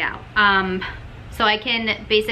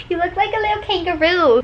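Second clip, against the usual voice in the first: much higher teasing coo; playful pet-talk; 9 semitones higher than usual. Real, not acted. Owner teasing her expressive dog, playful pet-talk.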